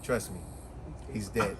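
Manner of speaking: low, soft, unbothered assurance; calm and flat. Retold or imitated. Rhoda calmly reassuring Annette about the body, low and soft. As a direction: say it low and utterly unruffled. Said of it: "calm as calm could be".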